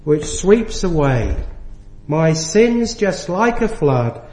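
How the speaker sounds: each hymn line spoken slow and measured; slow hymn recital. Retold or imitated. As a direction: recite the old hymn slowly, savouring it. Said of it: "and it goes like this".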